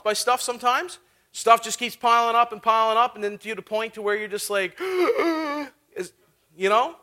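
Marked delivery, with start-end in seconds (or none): 4.8-6.1 mock anxiety gasp. Acting out an anxiety attack.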